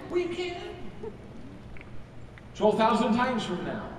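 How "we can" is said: bright reassurance. Real, not acted. Answering the wistful audience.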